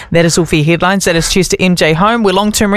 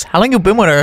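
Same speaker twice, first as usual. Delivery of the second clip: movie quote impression. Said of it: "then goes".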